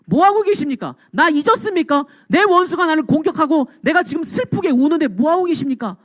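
high, protesting plea to God; acted anguished reproach, pitched high; resentful prayer. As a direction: complain to God in urgent questions. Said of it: "그 기도도 지금 원망투성이잖아".

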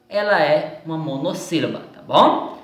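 ordinary talk

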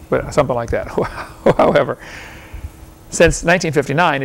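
normal talking voice

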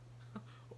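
short, soft whimsical chuckle; a quiet rueful laugh; breathy soft laugh. A narrator's reading. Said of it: "he laughed".

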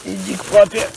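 put-on cooing baby talk. Coaxing the mushroom like a baby.

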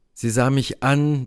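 usual speaking voice

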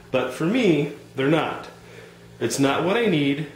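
normal talking voice

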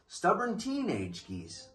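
ordinary delivery, nothing marked

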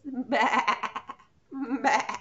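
loud laughing bleat; sheep baa. Shown as a demonstration. Teacher showing preschoolers the sheep sound, bleating. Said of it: "it makes us sound like this".

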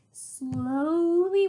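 ordinary delivery

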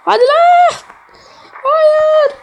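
high, loud cry of dismay; real despair at losing the level; dismayed shout. Real, not acted. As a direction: yell at the screen as the run fails. Character falls, player dismayed.

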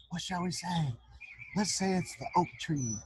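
ordinary delivery, nothing marked

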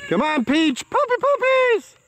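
very high squeaky calling to the dogs; excited pet call. Real, not acted. Calling dog and puppies excitedly.

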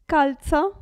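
Calza with the z said ts, kaltsa. Shown as a demonstration. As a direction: say Calza with a sharp ts. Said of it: "attenzione a come pronuncio io". (Calza con la z sorda, ts)

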